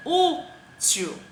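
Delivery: útil with a high, strong Ú; stressed first syllable. Shown as a demonstration. Teacher gives an example, Ú high and stressed. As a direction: lean hard on the Ú of útil. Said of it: "A sílaba tônica é a penúltima".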